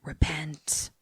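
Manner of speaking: hushed, soft ghostly whisper; ethereal voice. Retold or imitated. Eerie voiceover calling out in the dark house, ethereally. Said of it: "a ethereal voice that's like".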